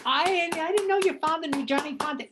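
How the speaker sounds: high, loud startled version of the line; shrill outburst. Retold or imitated. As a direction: put "Fontane" over the top as if startled. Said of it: "He wanted that".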